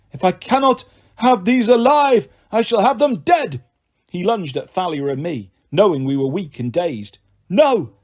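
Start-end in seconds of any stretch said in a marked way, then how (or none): 0.1-3.6 high, loud menacing monster voice; monster growl. A narrator's reading. Manticore threatening Percy and Thalia, menacing. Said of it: "The manticore growled". 7.5-7.9 high loud cry; yelled. A narrator's reading. Annabeth yelling as she charges the monster. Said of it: "Annabeth yelled".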